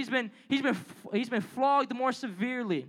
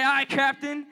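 higher, louder hearty shout of a sailor; shouted aye aye; 6 semitones higher, 6 dB louder, slower than usual. Shown as a demonstration. Youth pastor shows the crowd how to answer, shouted. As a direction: bark it back like a crew answering the captain. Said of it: "Yeah, say".

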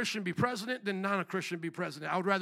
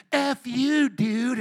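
put-on louder, higher taunting kid voice; mock rude kid; 3 semitones higher, 7 dB louder, much slower than usual. Kids at the park cursing back, mock rude voice. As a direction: toss it back like a mouthy teen. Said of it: "And they're just like".

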